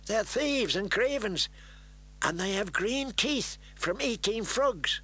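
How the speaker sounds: put-on high, sneering boy's voice; disdainful. A narrator's reading. Little Walder telling Rickon about the newcomers, disdainful. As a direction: pour scorn on the newcomers. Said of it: "answered Little Walder disdainfully".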